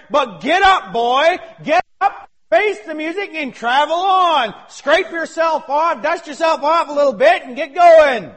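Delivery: loud, high, driving sermon; full-force preaching. Acted. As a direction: preach it hard to the congregation.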